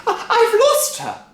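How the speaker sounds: high, loud stammering cry; panicked cry. A narrator's reading. Wife crying out for lost Grandma. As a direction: cry out searching. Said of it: "cried Missus Cranky".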